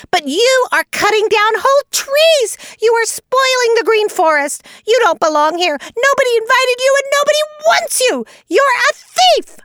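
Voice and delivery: high screeching tirade, building; excited screaming. A narrator's reading. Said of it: "the louder he screams".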